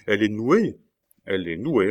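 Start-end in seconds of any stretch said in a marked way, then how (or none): 0.1-0.8 louder, astonished cry of delight; thrilled, disbelieving echo; joyful exclamation. A narrator's reading. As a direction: exclaim it as wonderful news. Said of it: "s'écria Cornélius".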